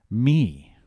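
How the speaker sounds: pause, then hit me hard; stress on me. Shown as a demonstration. Pastor on the catechism's redemption line, stressed me. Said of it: "Notice the emphasis I put on those words".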